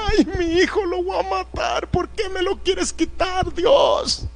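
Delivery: high, slow mock-crying lament, breaking into a sob at the end; mocking Hollywood Abraham wailing over his son. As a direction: ham up a weeping father begging God. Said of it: "No crean que él estaba llorando".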